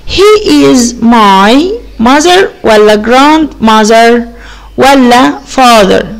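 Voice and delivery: slow, drawn out choices; slow reading of options. Shown as a demonstration. Teacher reading the choices slowly to pupils. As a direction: read each option slowly. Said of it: "reading the options".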